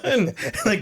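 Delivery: high giggling laughter; real amused laughter; laughing. Real, not acted. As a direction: laugh through the words. Laughs after the scene.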